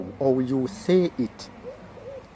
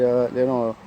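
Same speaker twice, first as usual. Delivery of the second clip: ordinary talk, nothing marked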